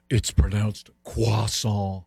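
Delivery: slow, drawn-out croissant with the R as a W; corrective French pronunciation. Retold or imitated. Correcting the speaker on a pastry name, "croissant" said slowly as "kwah-sawn". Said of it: "someone come up to me ... and go".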